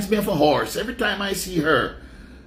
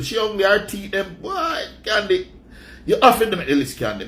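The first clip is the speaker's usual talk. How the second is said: ordinary delivery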